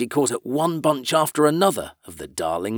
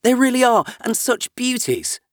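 delight; 12 semitones higher than usual. Acted. Alice admiring the rushes with delight. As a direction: savour the beauty of it. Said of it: "in a sudden transport of delight".

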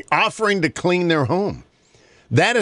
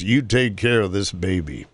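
firm commanding voice; 7 semitones lower than usual. Acted. Old man orders the mother to care for her baby, low and firm. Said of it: "he said in a firm, commanding voice".

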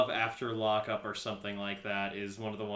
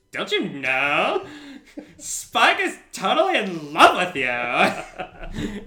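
teasing; 7 semitones higher, 7 dB louder, much slower than usual. Retold or imitated. Dawn teasing Buffy about Spike.

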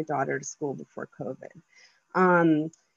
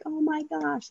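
excited daughter; 9 semitones higher than usual. Retold or imitated. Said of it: "She started jumping up and down and, like, smiling".

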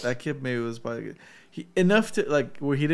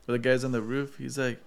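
usual speaking voice

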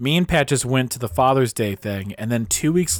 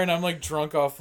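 ordinary talk, nothing marked

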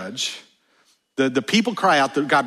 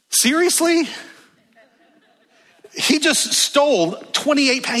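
can you believe this, sky-high; incredulous; 11 semitones higher than usual. Real, not acted. Preacher reacts in disbelief.

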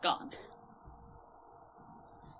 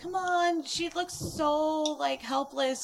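pleading wife; 7 semitones higher than usual. Retold or imitated. Wife voiced in a story.

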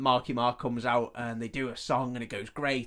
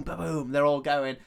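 ordinary talk, nothing marked

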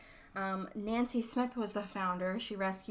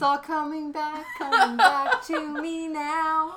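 laughing; 8 semitones higher, 8 dB louder, slower than usual. Real, not acted. Recalling sister spat on by monkey.

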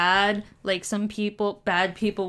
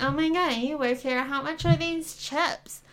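put-on valley girl teen; 8 semitones higher than usual. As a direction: play the clueless teenage customer. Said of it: "and she's like".

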